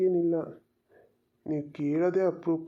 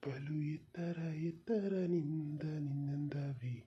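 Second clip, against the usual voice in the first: whisper; 3 semitones lower, 8 dB quieter than usual. Acted. Romantic verse whispered to her.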